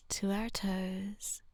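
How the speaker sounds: ordinary delivery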